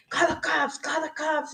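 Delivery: high-pitched mock yell, no real volume; retold yelling. Coworkers yelling at her as she arrives, high mock yell. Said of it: "they're yelling at me".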